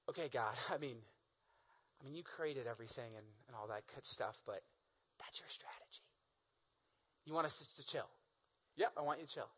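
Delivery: low, very soft, hesitant questioning; doubtful, hushed. Acted. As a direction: quietly question a baffling order, nearly whispering. Moses doubtfully questions God's strategy.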